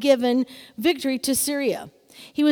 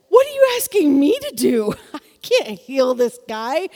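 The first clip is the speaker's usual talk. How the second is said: panicked king; 4 dB louder than usual. Retold or imitated. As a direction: throw up your hands in a mock panic. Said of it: "the king freaks out".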